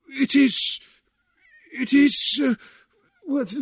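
high, halting fumble for words; puzzled, helpless spluttering. A narrator's reading. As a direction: stammer as if cornered. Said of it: "then spluttered helplessly".

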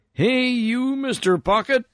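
high, far-carrying holler; calling down. A narrator's reading. Miner hails the gold pocket down in the canyon, calling out. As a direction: hail someone far below, pitch way up. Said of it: "he called down into the canyon".

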